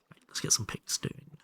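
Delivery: soft unvoiced whisper to himself. Real, not acted. Streamer quietly talking to himself while crafting, whispered. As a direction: breathe the words without voice.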